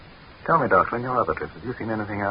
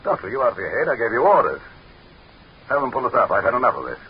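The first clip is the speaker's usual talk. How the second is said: higher, louder furious commands; angry orders; 4 semitones higher, 3 dB louder than usual. Acted. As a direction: bark the orders, losing patience. Leader demanding to surface.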